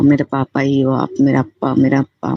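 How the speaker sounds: normal talking voice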